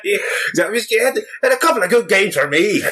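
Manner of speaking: a light mock Northern Irish voice with a laugh; Northern Irish accent. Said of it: "your Northern Irish voice".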